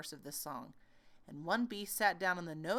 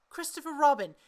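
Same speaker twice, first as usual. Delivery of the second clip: calling out; 8 semitones higher, 9 dB louder than usual. Acted. Pooh calling down from balloon.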